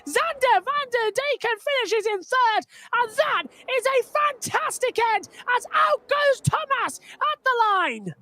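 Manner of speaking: building excitement, pitch climbing towards the finish; thrilled motorsport play-by-play; live race commentary. Real, not acted. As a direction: call a photo finish live, then draw out the ending. Live call of photo finish.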